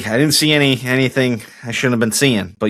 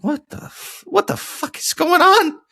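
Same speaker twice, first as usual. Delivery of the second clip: retold bewilderment. Startled reaction to cries, retold.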